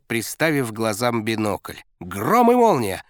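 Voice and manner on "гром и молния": loud, high excited yelling; barking gym coach. A narrator's reading. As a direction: yell it over engine noise. Coach yelling during race.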